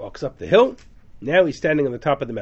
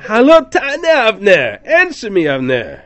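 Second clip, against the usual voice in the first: loud, high call across the valley; 8 semitones higher, 11 dB louder than usual. Retold or imitated. David on the hill calling down to Avner, loud. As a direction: shout it down from the hill.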